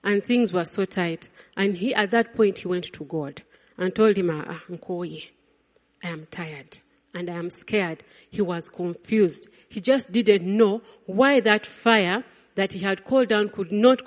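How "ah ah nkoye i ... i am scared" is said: weary, tired confession. Acted. Elijah confesses weariness.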